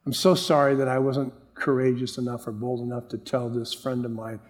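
low, soft apology in prayer; remorseful. Retold or imitated. Preacher recalling his apology to the Lord, quiet remorse. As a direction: confess quietly, weighed down with regret. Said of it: "I just sat down and wept".

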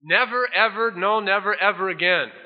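NEVER rising high and stressed each time; emphatic never. Retold or imitated. God swearing never ever again, every never stressed. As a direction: hammer the word never. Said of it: "God says".

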